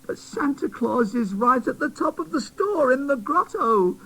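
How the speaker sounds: fairy scolding the new doll, high; tattered fairy. Acted. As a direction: worry at him. Griselda objects.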